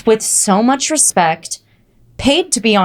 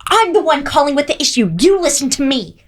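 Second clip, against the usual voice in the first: entitled customer; 6 semitones higher than usual. Retold or imitated. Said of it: "She'd be screaming to people on the phone".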